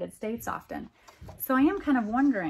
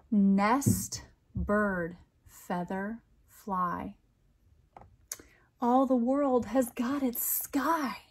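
slow, lilting picture-book reading; poem read aloud; much slower than usual. A narrator's reading. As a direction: read it like a bedtime rhyme for kids.

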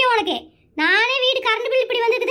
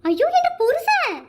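wailing; 6 semitones higher, slower than usual. Acted. Wife wails over husband on stretcher.

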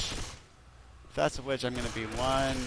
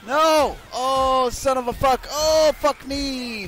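loud, pitched-up cursing; alarmed yell; 6 semitones higher, 6 dB louder, slower than usual. Real, not acted. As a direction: yell in sudden panic. Getting caught by witch.

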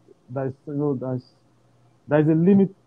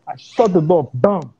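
ordinary delivery

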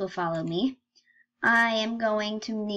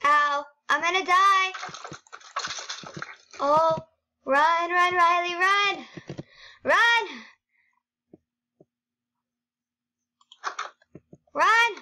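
panicked shouts, high and loud; being chased; 7 semitones higher, 4 dB louder, slower than usual. Real, not acted. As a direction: yell run while fleeing in panic. Mob chasing her in game.

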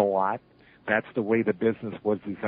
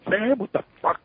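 high, outraged protest; indignant outburst; 11 semitones higher than usual. Retold or imitated. Wrestler yelling at the referee, high outraged protest. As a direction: fling it back at the ref, high.

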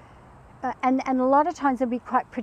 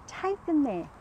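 ordinary talk

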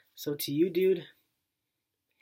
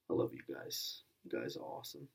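whispered and much softer; 13 semitones lower, 10 dB quieter, faster than usual. Real, not acted. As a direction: breathe it out, no voice at all. Emotional thank-you to friends.

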